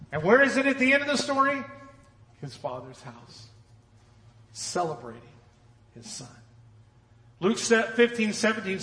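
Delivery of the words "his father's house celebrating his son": soft answer. Acted. Quiet repeat.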